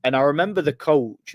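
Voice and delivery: ordinary talk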